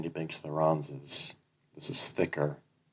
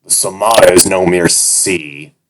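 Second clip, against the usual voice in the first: captor gloating to the servant about the ocean; smug captor; 14 dB louder than usual. Acted. Captor voice in story.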